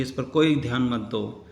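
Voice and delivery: ordinary talk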